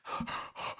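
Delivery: audible huffing breaths; mouth breathing. Shown as a demonstration. Coach showing tense runners' breathing, demonstrative mouth huffs. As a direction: imitate puffing in and out through the mouth. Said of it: "breathing in through and out through your mouth".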